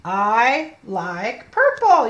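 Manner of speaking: put-on very slow, each word laid out for a child; slow model reading. Shown as a demonstration. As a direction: read it slowly so the child can follow. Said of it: "You read it".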